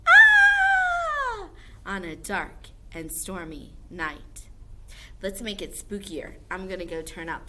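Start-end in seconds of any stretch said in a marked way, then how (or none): 0.1-1.5 very high, loud scream; creepy distant scream. Shown as a demonstration. Teacher shows the class a spooky scream. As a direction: scream like someone lost in a dark forest. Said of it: "People scream".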